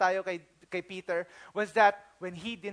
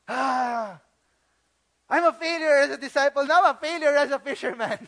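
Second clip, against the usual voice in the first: louder self-pitying wail; mock lament; 4 semitones higher, 7 dB louder than usual. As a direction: moan about being useless. Peter laments his failure.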